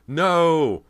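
loud terrified wail. A narrator's reading. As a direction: wail in horror at the fire. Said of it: "her own terrified wail".

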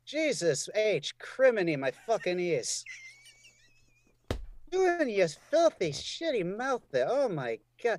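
high, loud scandalized scolding; mock outrage. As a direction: pretend to be appalled at the swearing.

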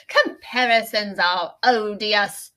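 proclaiming like a town crier. Pretend. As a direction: announce it to the village square. Mock proclamation of the quote.

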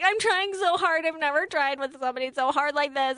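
high, pleading whine; whiny girlfriend. Retold or imitated. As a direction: plead like a drama queen. Mocking Victoria's whining.

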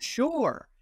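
high, bright-eyed retold yes; eager agreement. Airman agreeing to paint for the commander, eager. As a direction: agree readily, pitched well up.

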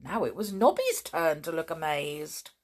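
usual speaking voice

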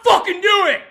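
high, very loud threat; threatening shout. Real, not acted. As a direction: yell it as an ultimatum. Furious threat shouted on call.

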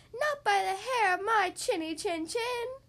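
high, drawn-out piggy voice; little pig. A narrator's reading. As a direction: refuse as a squeaky little storybook pig. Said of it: "said the little pig".

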